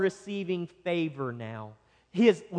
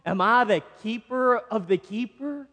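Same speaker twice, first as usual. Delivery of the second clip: higher, mock-questioning sarcasm; sarcastic; 4 semitones higher than usual. Preacher voicing Cain talking back to God, sarcastic. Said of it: "sarcasm that is here within Cain's voice".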